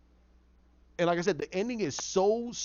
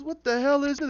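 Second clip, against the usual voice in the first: high, raised exclamation; startled outburst; 10 semitones higher, 4 dB louder than usual. Retold or imitated. Boss reacting to the strange fit, startled. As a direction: blurt it in alarmed disbelief.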